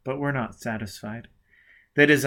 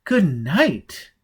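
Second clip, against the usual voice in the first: pointed repeat; 5 semitones higher, 6 dB louder than usual. Acted. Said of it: "she repeated".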